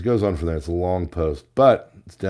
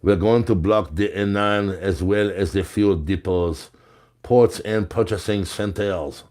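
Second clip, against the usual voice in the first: put-on French accent. Host reads a protesting farmer's quote, French accent. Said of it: "in my French accent".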